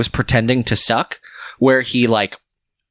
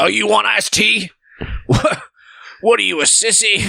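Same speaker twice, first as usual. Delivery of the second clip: high-pitched, taunting retold jeer; mocking barfly; 9 semitones higher than usual. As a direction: act out a bar bully's taunt, pitched high. Retold barfly mocks tea order.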